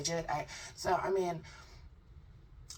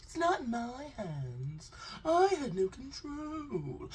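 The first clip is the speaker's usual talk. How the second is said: much higher, slow, fake crying whine; mock whining; 9 semitones higher, slower than usual. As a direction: sob it out like a caught kid. Mock whiny crying guest.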